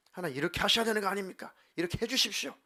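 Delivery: acted-out insistent protest; protesting to God. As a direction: press your case urgently, as in heated prayer. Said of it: "항변을 하면서".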